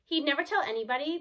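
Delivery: plain reading voice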